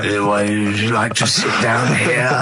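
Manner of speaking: slow smarmy welcoming voice; creepy host. Pretend. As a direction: lay on the smarm, slowly.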